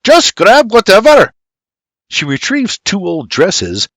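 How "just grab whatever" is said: high, loud impatient holler; shout. A narrator's reading. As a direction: shout to hurry her. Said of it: "Geoffrey shouts".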